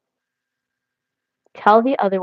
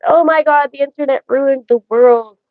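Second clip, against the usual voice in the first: raised, louder mock exclamation; mock complainers; 5 semitones higher, 4 dB louder than usual. Imitating everybody complaining about the internet, mildly mock-dramatic. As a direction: lightly mimic everybody's outcry, pitched up. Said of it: "Like, everybody says".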